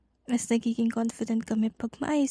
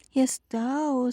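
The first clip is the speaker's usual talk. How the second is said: ordinary talk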